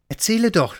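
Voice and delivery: high, eager little Santa; excited high-pitched prompting; excited cry. A narrator's reading. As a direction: urge him on impatiently, pitched high. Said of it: "rief der kleine Weihnachtsmann aufgeregt".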